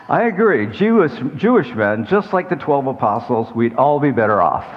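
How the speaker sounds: high, bright mock of his daughter; teasing daughter. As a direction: tease the pastors in the daughter's voice. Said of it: "my daughter, who is present here today, says".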